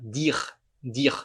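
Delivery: "dire" as deer, throaty R last; one-syllable "dire", E dropped; silent final E. Shown as a demonstration. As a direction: end "dire" on the R. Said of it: "a gente omite esse E".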